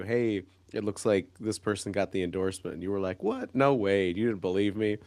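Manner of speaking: ordinary talk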